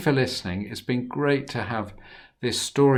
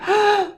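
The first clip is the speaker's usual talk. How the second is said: gasp; 9 dB louder than usual. A narrator's reading. Brothers' fright.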